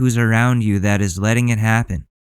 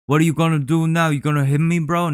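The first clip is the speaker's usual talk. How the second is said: higher mocking taunt to the camera; a light mocking voice; mock filming taunt; 5 semitones higher than usual. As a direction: sneer like a smug guy filming for YouTube. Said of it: "I wouldn't be like".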